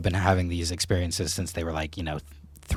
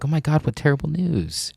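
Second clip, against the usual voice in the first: higher-pitched townsfolk lament; mock dismay; 7 semitones higher than usual. Townspeople to the farmer over lost horses, mock dismay. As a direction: imitate townsfolk aghast at bad news.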